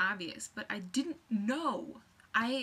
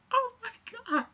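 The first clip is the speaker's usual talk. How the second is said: high loud shriek of surprise; excited shriek; 7 dB louder, slower than usual. Retold or imitated. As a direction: squeal it with shock and joy. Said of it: "the sound effects that I'm making".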